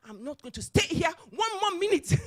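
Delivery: high, loud mock huff; retold indignant outburst. Executive grabbing her bag to leave, indignant.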